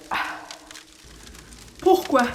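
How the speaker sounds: ordinary talk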